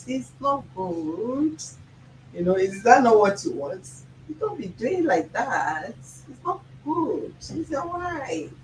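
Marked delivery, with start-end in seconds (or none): 0.1-1.6 gentle scold. Pretend. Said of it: "if the man come and say". 4.3-8.5 high, slow, soft sweetened voice; soft mock voice. Shown as a demonstration. Host mocks the calm voice fans want, high and soft. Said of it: "is that the kind of voice you guys want to be hearing".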